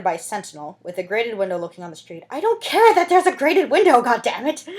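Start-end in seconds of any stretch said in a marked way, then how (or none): 2.3-4.7 loud, high exasperated rant; exasperated outburst. Real, not acted. Reader breaking off from the book to vent, loud. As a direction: blurt it out, fed up with the text.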